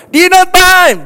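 high loud holler of "Dinner time!"; calling out. Retold or imitated. Pastor imitates a parent shouting "Dinner time!" before switching to a message.

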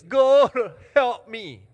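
loud, high hammed-up cry to God; mock desperate prayer. Shown as a demonstration. Preacher shows congregation how they pray, mock desperate cry. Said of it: "pray prayer like this".

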